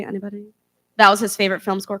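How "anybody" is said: the same question dropped much softer, trailing off; quieter repeat. Real, not acted. Speaker to silent audience, soft trailing repeat. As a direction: ask the crowd again under the breath.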